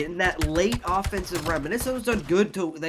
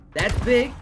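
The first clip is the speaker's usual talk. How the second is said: excited; 7 semitones higher, 8 dB louder than usual. Real, not acted. Caster calls a big kill.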